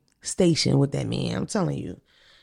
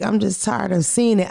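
ordinary delivery

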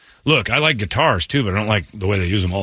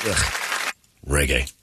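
ordinary talk

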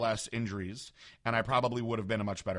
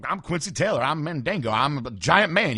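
loud, higher-pitched boast; boastful big man; 6 semitones higher, 9 dB louder than usual. Retold or imitated. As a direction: imitate a giant man proclaiming himself. Acted boast by big bodybuilder.

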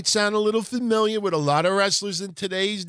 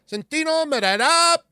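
much higher, louder mock Italian accent on the name; cardboard Italian announcer; 11 semitones higher, 5 dB louder than usual. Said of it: "the accent was cardboard".

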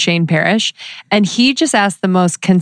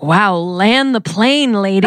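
slow mock drawl; slower than usual. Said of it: "And I was like".

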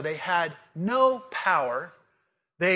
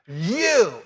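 "You" punched on its own, louder; emphatic you; 3 semitones higher, 6 dB louder than usual. Shown as a demonstration. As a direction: hit "You" hard as a one-word statement. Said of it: "it is an emphatic you".

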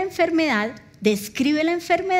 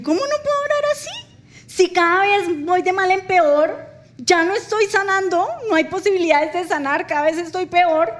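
much higher, louder, crying protest; tearful protest; 6 semitones higher, 3 dB louder than usual. Retold or imitated. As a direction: plead through tears to the husband. Her tearful protest to husband.

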